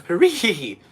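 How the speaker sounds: loud laughing hooray; first try at a cheering hooray; cheer take. Shown as a demonstration. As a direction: shout it with a laugh. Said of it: "That was terrible".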